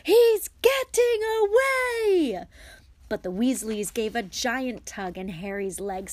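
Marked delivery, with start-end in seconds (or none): 0.0-2.5 frantic high bellow, building longer; Uncle Vernon's roar. A narrator's reading. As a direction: roar it, stretching the repeat into a long yell. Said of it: "roared Uncle Vernon".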